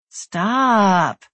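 drawn-out gentle stop; drawled. A narrator's reading. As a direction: drawl the word out. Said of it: "Cody drawled".

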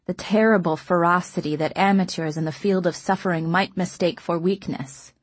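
plain reading voice

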